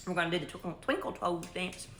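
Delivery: talking around a hot bite; mouth full. Real, not acted. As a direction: speak through a mouthful of hot food. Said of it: "Can't even talk".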